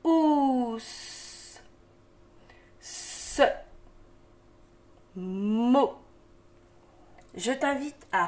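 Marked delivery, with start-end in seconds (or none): 0.0-0.8 "Ous" with long vowel gliding into a held s; inverted syllable. Shown as a demonstration. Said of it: "le son voyelle est devant". 2.8-5.9 "Ce" and "Mot" apart, first consonant held long; syllable drill. Shown as a demonstration. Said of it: "j'accorde avec la consonne devant".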